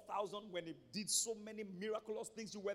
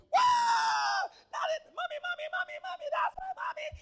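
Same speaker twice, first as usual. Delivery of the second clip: put-on squealing child, very high and loud; talking doll; 14 dB louder than usual. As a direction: shriek with delight like a little girl. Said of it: "two daughters, for example".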